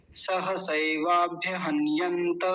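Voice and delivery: सहसैवाभ्यहन्यन्त said as one slow flowing word; joined slow recitation. Shown as a demonstration. Teacher showing the joined word, slow and smooth. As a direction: run सहसैवाभ्यहन्यन्त together slowly. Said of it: "ऐसे बोल देंगे".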